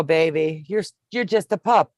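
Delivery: ordinary talk, nothing marked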